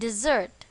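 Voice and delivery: "dessert" with the stress on its second syllable, di-ZERT. Shown as a demonstration. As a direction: lean on the end of "dessert", di-ZERT. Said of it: "On the second syllable".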